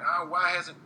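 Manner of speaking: lightly put-on super country accent. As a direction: mimic a complaining fan in country twang. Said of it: "I always do this in a country voice".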